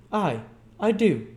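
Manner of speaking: put-on defiant young woman. A narrator's reading. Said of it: "returned the girl, meeting his gaze".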